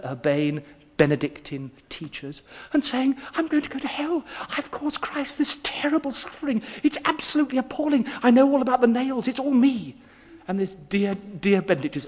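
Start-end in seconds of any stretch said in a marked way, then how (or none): 3.3-10.0 much higher, rushing, a frightened schoolboy; imitating a distraught boy spilling out guilt; panicked boy. Boy confessing to his teacher, higher and hurried. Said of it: "in tears".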